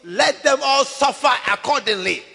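put-on loud high plea of a murdered girl; crying out for revenge. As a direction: demand revenge from God, loud. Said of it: "she would say".

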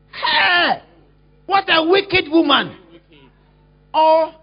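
loud scornful shout; loud shocked rebuke; scolding shout. Retold or imitated. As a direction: scold the woman loudly. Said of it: "is that what you say?".

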